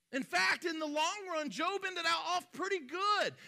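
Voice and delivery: high, loud sermon delivery; forceful preaching. Real, not acted.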